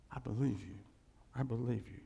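low, soft affirmation; hushed sincere assent. Acted. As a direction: answer God quietly and low. Acts believer answering God.

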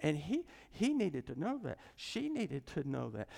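usual speaking voice

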